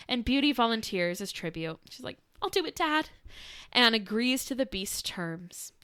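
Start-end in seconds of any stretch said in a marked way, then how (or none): none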